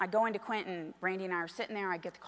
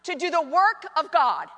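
loud, raised, slow proclamation; big deliberate preacher's voice; preaching; 7 semitones higher, 9 dB louder, slower than usual. Acted. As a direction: declaim the quotation with full pulpit force.

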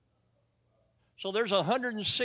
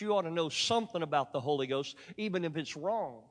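usual speaking voice

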